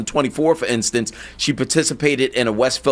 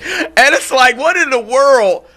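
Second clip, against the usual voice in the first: incredulous outrage; 10 semitones higher than usual. Real, not acted. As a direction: let the disbelief pour out, pitched way up. Incredulous rant at impostor.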